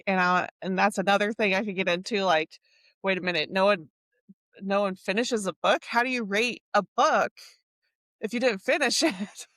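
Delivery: ordinary delivery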